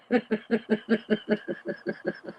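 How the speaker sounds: high breathy burst of laughter; real laugh. Real, not acted. Host cracking up at the taters joke, real laugh. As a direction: let the laugh roll out.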